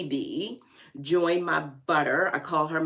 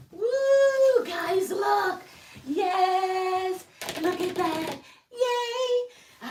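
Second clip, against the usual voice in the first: very high singsong squeals; high giddy singsong cheers; squealing joy; much slower than usual. Real, not acted. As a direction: sing out the delight.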